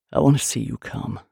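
low, controlled male voice; low demand. A narrator's reading. Man makes a demand of his lover, low. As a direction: state it low and steady.

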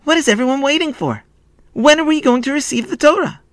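put-on high, urgent boy's questions; eager high-voiced shouting; excited shout. A narrator's reading. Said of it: "he shouted".

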